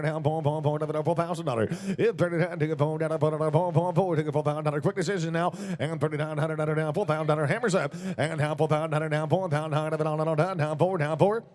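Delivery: normal talking voice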